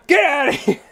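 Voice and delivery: high-pitched shout breaking into laughter; mock yelling. Shop owner yelling at a customer, in pretend fury. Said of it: "just yelling at him".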